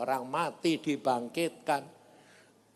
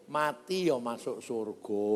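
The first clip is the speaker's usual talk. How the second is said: ordinary delivery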